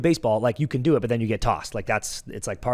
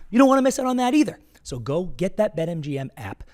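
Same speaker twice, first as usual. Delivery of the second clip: high, loud, hyped sponsor pitch; upbeat ad read; 4 semitones higher, 3 dB louder, faster than usual. Acted. Host pitching the sportsbook promo to listeners in a hyped ad read. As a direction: sell the bet like a hype man.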